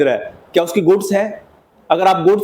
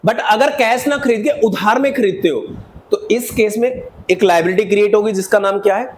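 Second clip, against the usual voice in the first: ordinary delivery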